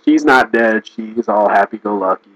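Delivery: ordinary talk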